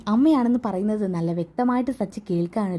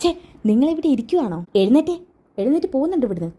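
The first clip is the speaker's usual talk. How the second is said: raised, higher-pitched scolding; angry ordering out; 5 semitones higher than usual. Acted. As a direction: order an unwanted visitor out, indignantly. Ordering an unwanted visitor out. (ദേഷ്യത്തോടെ ഇറക്കിവിടുന്ന ശബ്ദം)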